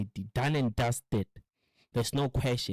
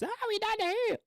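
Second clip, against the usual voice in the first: high falsetto announcement; idiot friend; faster than usual. Retold or imitated. Idiot friend giving away their spot in a high falsetto.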